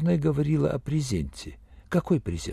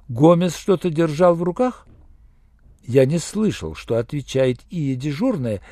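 usual speaking voice

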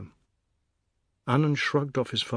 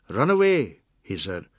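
loud, higher-pitched shooing; gruff dismissal; 8 semitones higher, 7 dB louder than usual. Acted. Fat man chases a boy away, loud dismissal. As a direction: send the kid packing, loudly.

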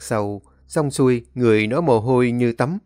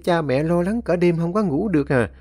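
lightly voiced fretting mother, higher; a mother fussing over her son home late; a hint of an anxious old mother; worried mother; 6 semitones higher than usual. A narrator's reading.

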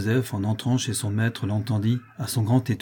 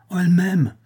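higher, overjoyed outburst on M'AIME; elated exclamation; 7 semitones higher, 3 dB louder than usual. A narrator's reading. Husband confiding his wife loves him, elated. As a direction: exclaim it in sudden rapture. Happy husband repeats it more ardently.

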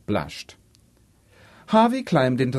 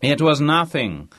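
grandly modest and a bit louder; expansive; 5 semitones higher, 5 dB louder than usual. Acted. Harvey shrugging off his donation to the dons, expansive. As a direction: wave it off with a big man's ease. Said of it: "said Harvey expansively".

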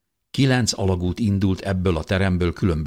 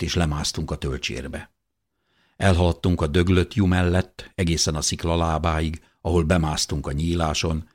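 ordinary delivery, nothing marked

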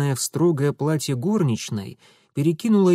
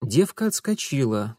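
usual speaking voice

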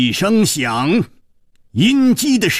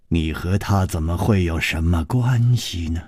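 plain reading voice

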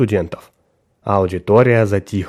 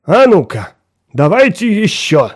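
exclaiming; 7 semitones higher than usual. Acted. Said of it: "Уоррендер воскликнул".

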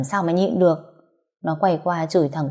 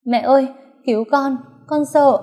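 higher, louder cry for help; frightened plea; 8 semitones higher, 4 dB louder than usual. Acted. Beaten daughter crying to her mother for help, frightened and loud. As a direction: cry out to mum in fear.

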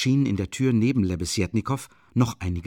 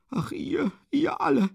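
high, thin, breathy lament; despairing reproach. A narrator's reading. Katerina to all the accusers, despairing. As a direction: let the voice drop to a high, airy cry.

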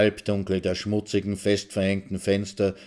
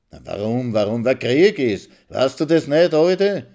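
rising, louder mockery; taunting; 5 semitones higher, 7 dB louder than usual. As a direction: needle her, getting higher. Maxl taunts the old woman.

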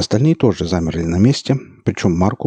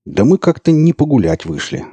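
higher, louder impatient retort; losing patience; 8 semitones higher, 5 dB louder than usual. A narrator's reading. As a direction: snap back, out of patience. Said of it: "теряя терпение".

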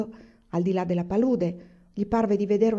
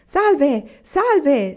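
much louder, higher hello; shouting greeting; 8 semitones higher, 9 dB louder than usual. Acted. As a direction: hail the strangers at the top of his voice. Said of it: "si mise a gridare". (saluto gridato, molto più forte e alto)

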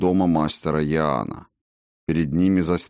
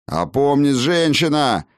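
higher, raised angry command; barked rebuke; 8 semitones higher, 4 dB louder than usual. A narrator's reading. Master rebuking his wavering wife, barking. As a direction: bark it to bring her to her senses. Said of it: "рявкнул мастер Яан".

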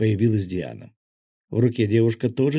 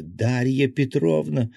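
calling out, slow and a little higher; a half-raised narrated cry of the name; mild cry; 5 semitones higher, 4 dB louder, slower than usual. A narrator's reading. Anya calls to her old governess, mild cry. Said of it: "закричала Аня".

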